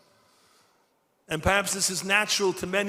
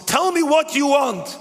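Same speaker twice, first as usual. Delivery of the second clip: louder, higher, pressing for an answer; urgent demand; 8 semitones higher, 8 dB louder than usual. Retold or imitated. Old mentor pressing the prisoner to answer, urgent. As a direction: demand it, shaking him awake.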